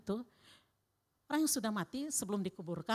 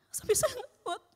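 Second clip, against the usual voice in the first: real crying welling up; tearful; 11 semitones higher than usual. Real, not acted. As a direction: voice breaking into tears, pitched high. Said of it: "Saya nangis sendiri". (suara pecah karena menangis)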